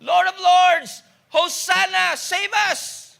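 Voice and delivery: slow, high and loud acclaim; crowd shouting. Retold or imitated. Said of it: "some of them are shouting".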